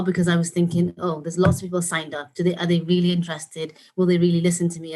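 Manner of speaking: ordinary talk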